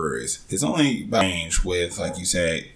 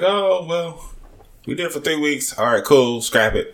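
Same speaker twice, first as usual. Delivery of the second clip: higher-pitched imitation of a brewer giving up; mock breezy resignation, pitched up; mock dismissive; 9 semitones higher, 4 dB louder than usual. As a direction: shrug it off in a raised voice. Voicing a brewer shrugging off a release.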